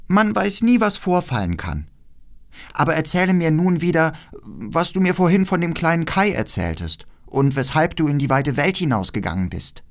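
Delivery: hohe, lebhafte Mädchenstimme; freches Räubermädchen. Acted. Voices the robber girl. (keckes, forderndes Räubermädchen)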